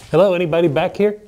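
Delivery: ordinary talk, nothing marked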